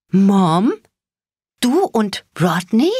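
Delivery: high, loud, stunned question; shocked disbelief. A narrator's reading. Daughter confronts her mother, stunned and loud. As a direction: she can't believe what she sees.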